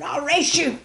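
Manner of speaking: put-on loud, high shout of a boy daring others; excited holler to race. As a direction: yell the challenge across the playground. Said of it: "the boy hollered".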